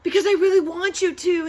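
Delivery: put-on high, loud childish insisting; insistent. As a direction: demand it like a spoiled kid, loud. Lulu insisting.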